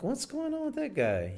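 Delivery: high, mock suspicious question. Wary woman eyeing a guy who hides something. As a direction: squeak out mock distrust. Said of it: "I'm like".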